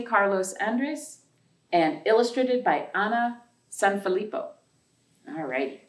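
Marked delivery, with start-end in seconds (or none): none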